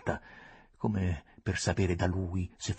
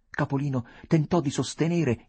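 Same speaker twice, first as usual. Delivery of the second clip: ordinary delivery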